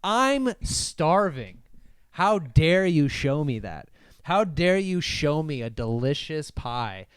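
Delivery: slow outrage. Retold or imitated. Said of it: "you're like".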